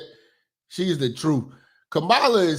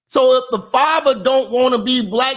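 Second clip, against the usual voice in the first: heated rant; 12 semitones higher than usual. Real, not acted. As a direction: preach it at full volume, fed up. Ranting at viewers about race.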